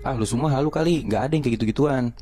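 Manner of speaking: high-pitched, mildly scoffing boss; dismissive retort. Retold or imitated. Boss dismisses the ghost claims. (nada meremehkan)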